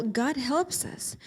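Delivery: ordinary delivery, nothing marked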